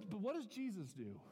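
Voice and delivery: ordinary delivery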